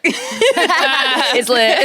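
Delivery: amused, laughter breaking in; laughing. Real, not acted. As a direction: crack up while riffing on a joke. Both laughing at the joke.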